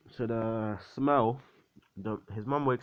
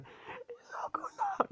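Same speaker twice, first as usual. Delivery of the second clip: thin, mock-crying squeal; crying mother; 6 dB quieter, slower than usual. Mother sees her son stab himself, acted crying. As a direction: squeak it out through pretend tears. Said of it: "like, crying and stuff".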